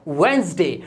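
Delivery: वेडनेसडे as two syllables, "WENZ-day"; silent D. Shown as a demonstration. Teacher models for learners, वेडनेसडे said "wenz-day". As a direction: drop the first D in वेडनेसडे. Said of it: "इसमें डी silent होता है".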